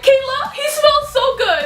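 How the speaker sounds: high, slow swooning friend. Retold or imitated. Friend swooning to Kayla about Luke's smell, high and slow. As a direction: coo it like a starstruck fan. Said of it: "being the weirdo she is".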